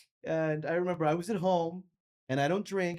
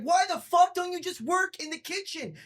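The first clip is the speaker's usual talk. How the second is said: angry rant; 10 semitones higher than usual. Real, not acted. Ranting at rude server.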